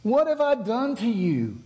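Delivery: high, put-on donkey voice, pleading; talking donkey voice. The donkey asks Balaam why he beat it, high put-on voice. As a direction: let the donkey complain in a high silly voice. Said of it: "He says".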